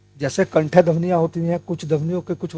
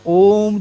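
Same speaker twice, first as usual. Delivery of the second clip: ओम with a lengthened ओ, raised and firm; stretched ओम; 3 semitones higher, 5 dB louder than usual. Shown as a demonstration. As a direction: stretch the ओ in ओम a little. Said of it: "ओ जो था बहुत लंबा खिंचा". (ओम का ओ थोड़ा खींचकर)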